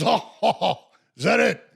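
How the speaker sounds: lightly voiced Pacino parroting the question; mock Al echo. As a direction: echo him with a teasing lift. Al mocking the question back.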